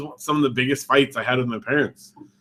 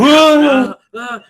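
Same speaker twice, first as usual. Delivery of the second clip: very high, loud gasp; startled shriek; 13 semitones higher, 8 dB louder than usual. Retold or imitated. Dad showing waking from a dream, startled shriek. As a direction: jolt awake with a yelp. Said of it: "and then it just".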